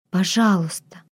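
breathy pleading please, almost a whisper; breathless whisper. A narrator's reading. Heroine begs Mister X over the phone, breathless whisper. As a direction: whisper it hot and short of breath. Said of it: "голос сбивался на горячий шёпот".

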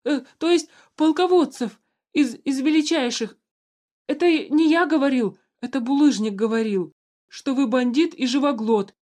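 high loud panicked babbling. A narrator's reading. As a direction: stammer excuses in terror. Terrified guard babbling excuses.

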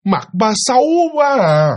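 giọng bé chê cao hơn, chậm; nhại bé con chê mặt ba, hơi cao; giọng con nít. Retold or imitated. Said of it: "nó mới trả lời lại một cái câu". (đứa con chê mặt ba, giọng trẻ con cao và chậm)

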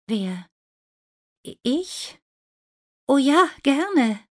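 surprised delight. Acted. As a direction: stammer in disbelief, then gush. Lina surprised to be asked.

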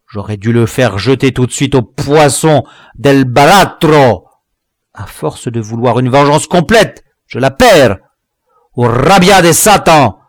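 high, loud cursing and threats; raging imprecations. A narrator's reading. Thwarted dwarf threatening his men, raging imprecations. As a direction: pour out furious curses on the disobedient. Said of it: "en imprécations et en menaces".

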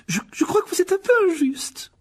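put-on very high, tremulous girl. A narrator's reading. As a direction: protest in a squeaky, strained voice. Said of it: "voix un peu plus aiguë qu'à l'ordinaire". (voix très aiguë, indignée)

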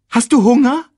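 high, quick cartoon meerkat; chirpy little sidekick voice. Timon asks.

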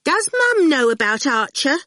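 lightly voiced little girl; bossy little girl. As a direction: pitch it up like a cheeky small girl. Girl Awful voiced in story.

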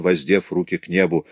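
ordinary delivery, nothing marked

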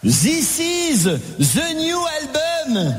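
put-on slow, heavy French accent, z for th; mock French accent in English. Comedian mocking French people speaking English, slow French accent. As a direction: drawl "This is" as a Frenchman saying "zis iz". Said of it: "vous êtes aussi bon pour faire l'accent québécois que pour parler anglais".

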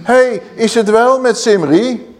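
high, slow mocking call from a window; a hammed-up queen calling out hey; Jezebel calling down. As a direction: taunt him from above. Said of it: "riep zij".

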